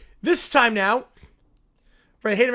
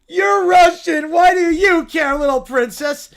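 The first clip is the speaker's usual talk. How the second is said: louder, higher bratty yell; mocking shout; 4 semitones higher, 6 dB louder than usual. Caillou snapping at the little princess, loud bratty yell. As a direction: sneer at her with a shout.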